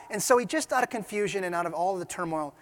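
ordinary delivery

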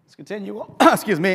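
husky, throat-clearing voice; a frog in the throat, clearing it. Real, not acted. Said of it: "get my frog in my throat".

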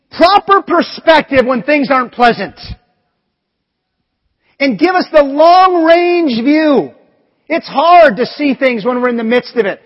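loud, rising sermon climax; full-voiced pulpit preaching; preaching at full force. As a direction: preach it out to the whole room.

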